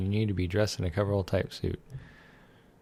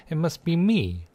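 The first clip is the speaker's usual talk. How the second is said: higher, brighter delight; excited; 9 semitones higher, 4 dB louder, slower than usual. Real, not acted. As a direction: gush with sudden delight. Mirror baby discovery.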